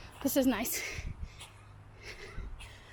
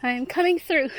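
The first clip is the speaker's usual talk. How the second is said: loud call, falling end; coming through shout; 2 semitones higher, 8 dB louder than usual. Real, not acted. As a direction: announce yourself to the woods. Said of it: "Letting wildlife know I'm here".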